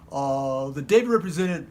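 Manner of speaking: normal talking voice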